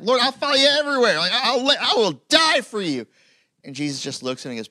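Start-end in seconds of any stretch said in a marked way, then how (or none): 0.0-3.1 high, loud eager vow; dogmatic Peter. Retold or imitated. Peter vowing to Jesus, loud and high-pitched. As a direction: swear loyalty at the top of your voice. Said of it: "this dogmatic moment where Peter's like".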